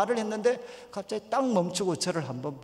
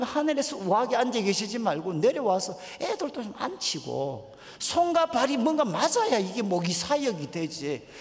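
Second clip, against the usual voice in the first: re-enacted outburst, raised and indignant; angry complaint; 6 semitones higher than usual. Young pastor railing at God about his ministry, re-enacted angry complaint. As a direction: protest upward in mock exasperation. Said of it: "막 그러면서 하나님께 화를 막 냈어요".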